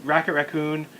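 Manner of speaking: ordinary talk, nothing marked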